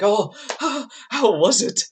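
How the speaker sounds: high, laughing through the words; can't hold back the laugh; giggling echo of the question. Retold or imitated. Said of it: "I start laughing".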